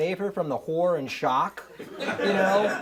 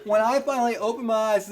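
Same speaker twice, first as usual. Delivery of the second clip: normal talking voice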